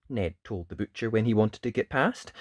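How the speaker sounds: put-on lively read-aloud voice for children; animated picture-book narration; storybook reading. A narrator's reading. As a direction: tell it like bedtime story time.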